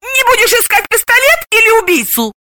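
very high-pitched wary query; suspicious, high-voiced woman; wary question. A narrator's reading. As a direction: ask your friend guardedly. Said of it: "Подруга настороженно осведомилась".

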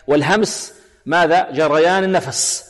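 usual speaking voice

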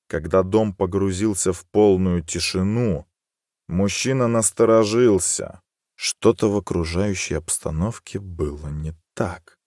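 ordinary delivery

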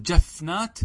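جفنات said "jafnāt", closed on the f, as a question; fa left unvoweled. Shown as a demonstration. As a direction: ask with جفنات as jaf-nāt. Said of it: "لا". (جفنات بسكون الفاء)

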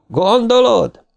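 Bill shouting back to Audra; shouted back. A narrator's reading. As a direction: call it back over the wind. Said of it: "kiáltott vissza".

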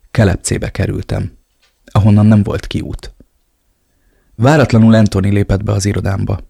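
ordinary delivery, nothing marked

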